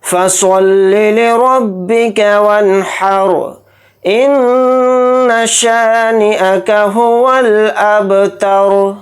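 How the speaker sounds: slow, drawn-out chanted tilawat; Quranic recitation. Acted. Teacher opening the lesson, slow chanted recitation. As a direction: intone each word slowly and reverently.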